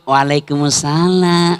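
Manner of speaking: slow, sing-song, seductive greeting; a languid, crooning answer on the phone; mock sultry reply. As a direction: drag it out sweetly to tempt the caller. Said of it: "jangan mendayu-dayu".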